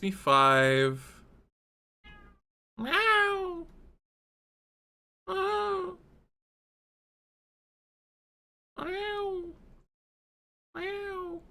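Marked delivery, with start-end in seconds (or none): 2.8-11.4 high teasing cat meows; repeated playful cat imitation; mock meows. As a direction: keep meowing back at the cat.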